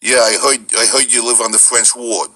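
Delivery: New Orleans Yat that sounds like Brooklyn; a Brooklyn-sounding New Orleans Yat accent; Yat dialect. Shown as a demonstration. Voice director demonstrates Mafia Three's dialect, in a Yat accent. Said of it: "had the Yat dialect in it".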